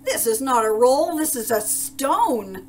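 loud, high, scornful bellow; outraged roar. A narrator's reading. Duke rejecting the baker's roll, roaring angrily. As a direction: roar it, stretching the final words. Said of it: "roared the Duke".

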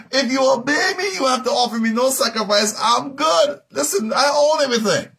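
high, loud preacher's voice for God; exasperated God. Acted. God scolding people who bring sacrifices, high, impassioned rebuke. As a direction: cry it out as an indignant command from on high.

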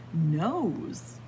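usual speaking voice

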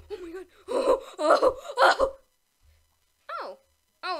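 gasping laugh of relief; relieved laughter. Real, not acted. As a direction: laugh out the tension. Narrow escape in round.

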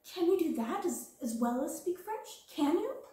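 amazed. Acted. Ermengarde amazed by Sarah.

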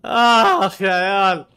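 slow loud groaning complaint; loud slow weary groan; loud exhausted groan. Real, not acted. Exhausted after fight.

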